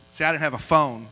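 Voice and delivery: usual speaking voice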